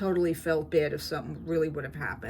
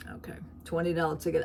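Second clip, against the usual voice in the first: ordinary talk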